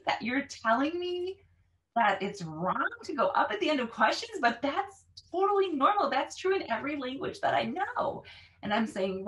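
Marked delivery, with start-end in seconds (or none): 0.2-8.2 high-pitched mock disbelief; imitated incredulous student. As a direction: act out a baffled student protesting, voice swinging high. Voices students' disbelief at the rule.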